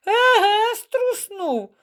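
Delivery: high, drawn-out mocking echo; laughing mockery. A narrator's reading. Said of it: "засміявся".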